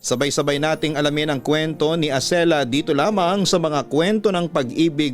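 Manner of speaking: normal talking voice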